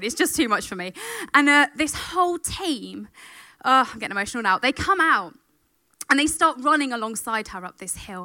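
ordinary delivery

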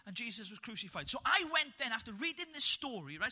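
ordinary delivery

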